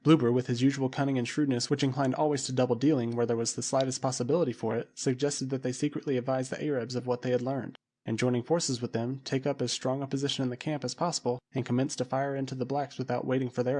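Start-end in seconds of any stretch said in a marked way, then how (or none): none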